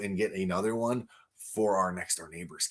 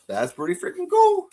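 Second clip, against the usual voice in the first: excited praise; 4 dB louder than usual. Real, not acted. Admiring the bigger figure again.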